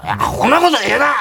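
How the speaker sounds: hammed-up Kansai telling-off; mock scolding. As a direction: imitate a comedy master cutting someone off in protest. Retelling master's flustered scolding.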